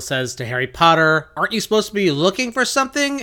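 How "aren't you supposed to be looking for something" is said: high, quick mock reminder; pointed prodding question. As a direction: prod someone who has forgotten. McGonagall prompts a forgetful Harry.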